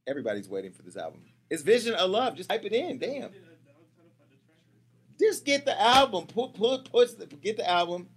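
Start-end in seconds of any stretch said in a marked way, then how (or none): 1.5-8.1 impatient. Real, not acted. Frustrated at slow search.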